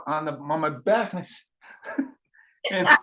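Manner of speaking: ordinary talk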